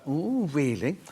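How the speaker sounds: catty little ooh of a tiff; mock affronted "ooh". Presenter recalling a bit of a contretemps, mock affronted. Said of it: "a little bit of a".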